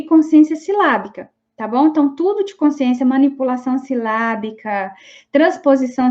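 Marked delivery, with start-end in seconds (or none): none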